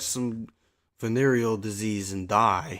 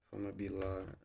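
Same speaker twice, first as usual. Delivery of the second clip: ordinary talk, nothing marked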